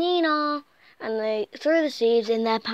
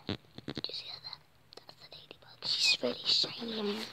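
whisper; 8 dB quieter than usual. Acted. Whispering about the ladybug.

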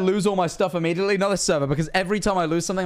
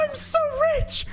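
high, drawn-out giddy yell; giddy squeal of triumph; gleeful cry. Real, not acted. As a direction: crow about the winnings.

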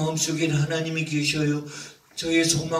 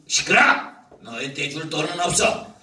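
gruff, annoyed rich man brushing him off; angry shout. Acted. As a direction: snap at the beggar to get lost. Rich man drives beggar away.

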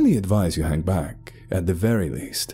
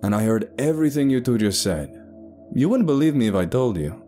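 raised-pitch call back from the front; yelled retort; 6 semitones higher than usual. Acted. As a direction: retort to the whisperers behind you. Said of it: "Sebastian yelled from the front".

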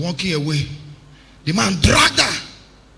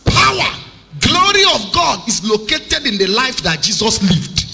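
full-force preaching; 10 semitones higher, 7 dB louder than usual. Real, not acted. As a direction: preach it at a shout, hammering each phrase home to the congregation.

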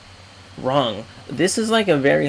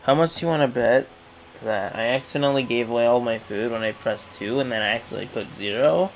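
ordinary talk

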